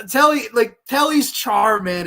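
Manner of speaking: loud, high burst of enthusiasm; excited outburst. Real, not acted. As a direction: blurt it out with delight. Excited praise of the actor.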